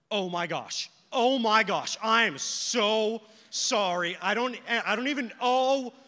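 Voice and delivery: loud, flustered mock apology; mortified apology. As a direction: act out being horrified at your own blurt, stumbling over the sorry. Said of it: "totally caught herself in this thing".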